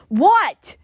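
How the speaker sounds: high, loud indignant scream. Retold or imitated. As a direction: shout it up high, insulted. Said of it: "I screamed".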